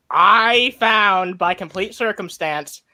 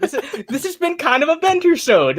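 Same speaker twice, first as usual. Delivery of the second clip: laughing; 12 semitones higher than usual. Real, not acted. Laughing at the joke.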